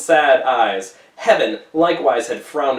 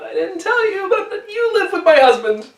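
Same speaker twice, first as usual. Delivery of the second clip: high, crying and breaking; crying confession; 4 dB louder than usual. Acted. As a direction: blurt it out through tears. Hester's horrible confession.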